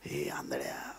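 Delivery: low, whispered hostile hail; hushed threatening. Acted. Crowd member warns the blind beggar, threatening whisper. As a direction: whisper it as a warning. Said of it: "अनेकांनी त्याला धमकावले".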